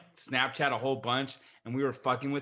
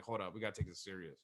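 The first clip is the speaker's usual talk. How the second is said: mildly hushed quote; 9 semitones lower, 13 dB quieter, faster than usual. Retold or imitated. Sobering up, quiet quote.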